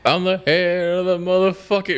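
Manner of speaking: high, put-on rapper voice; rapper quote. Friend quotes the YouTube rapper in a high hype voice.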